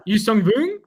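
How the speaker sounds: exaggerated French take on "Yuzong Vong"; mock French accent. Shown as a demonstration. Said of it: "now it's getting French".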